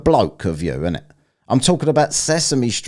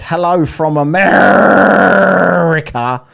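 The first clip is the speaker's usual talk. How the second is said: loud, higher mock Texan with AMERICA stretched out; pissed-off Texan accent; 8 semitones higher, 8 dB louder, slower than usual. Host reads a super chat aloud, pissed-off Texan accent. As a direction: holler it like an annoyed Texan, drawing it out. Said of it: "in the pissed off Texan accent".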